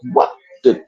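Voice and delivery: breathy whispered disbelief under his breath; a voiceless, stunned aside. Retold or imitated. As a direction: mutter it in hushed shock. Stunned on meeting Ramsing early.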